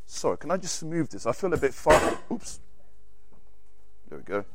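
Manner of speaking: ordinary talk, nothing marked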